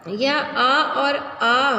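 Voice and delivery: आ and आ drawn very slowly, open sound between आ and ओ; in-between vowel demo. Shown as a demonstration. Teacher showing the English ऑ sound, very slowly. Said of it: "के बीच की ध्वनि है".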